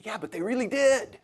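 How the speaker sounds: high-pitched imitated objection; mock protest. Acts out a listener's protesting objection.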